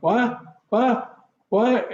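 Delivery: high, rising, repeated what; dazed, unable to take it in; high questioning shock; stunned disbelief. Retold or imitated. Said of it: "And you just sit there like".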